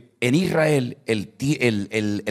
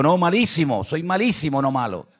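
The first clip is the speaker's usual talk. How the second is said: teasing retort; 7 semitones higher than usual. Retold or imitated. Pastor's joking retort.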